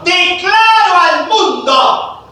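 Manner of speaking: DECLARO stretched long and high; grand proclamation. Shown as a demonstration. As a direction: proclaim DECLARO to the whole world. Teacher acts out proclaiming to world.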